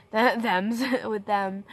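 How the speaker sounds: real laughter breaking through the words; laughing at her own tongue-slip. Real, not acted. Laughing while telling about mom chatting.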